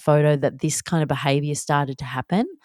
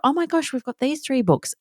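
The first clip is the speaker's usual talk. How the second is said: high, wondrous mock surprise; put-on delight for a small child; feigned excitement; 9 semitones higher than usual. Mum presenting swapped library books to her daughter, feigned excitement.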